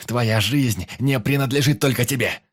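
loud angry retort. Acted. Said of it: "повысил тон голос".

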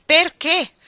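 loud, high, angry question; demanding shout. Acted. Iggy to Ruth about the marriage, demanding shout. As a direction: demand a reason at full voice.

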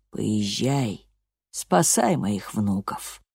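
low, slow, voice cracking with grief; voice breaking. Acted. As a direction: let the voice break as he speaks. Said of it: "Его голос прервался".

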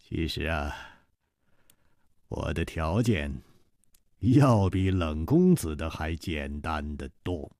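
chuckle, then low, soft, easy talk; leisurely amusement. Acted. Zhang Xiaolin naming his simple condition, leisurely. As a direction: laugh lightly and continue at ease, quietly. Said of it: "悠悠地笑道".

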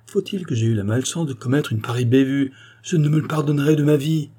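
high, upset exclamations; distraught self-reproach. A narrator's reading. Young man kneeling by his victim, distraught apology. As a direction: blame yourself, rising in distress. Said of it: "Le pauvre garçon était navré".

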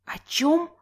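high, rising startled question; taken aback, incredulous; choked surprise. A narrator's reading. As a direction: nearly choke on the question, pitch shooting up. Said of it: "Снова подавилась Соня и закашлялась".

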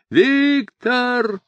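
very high, drawn-out call; high sustained cry into the distance; distant wail. A narrator's reading. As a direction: wail the name as if from far away. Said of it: "вопил Квадриго уже где-то вдалеке".

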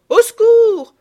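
very loud, high-pitched scream for help; piercing alarm call; shouted cry for help. A narrator's reading. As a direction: scream it out to bring rescuers. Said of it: "criaient les bonnes femmes".

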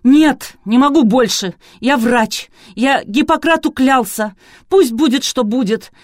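loud, high-pitched, tearful shout; desperate outcry. A narrator's reading. Doctor who swore to Hippocrates refuses to go on, crying out. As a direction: cry it out as if breaking down. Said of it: "Выкрикнул".

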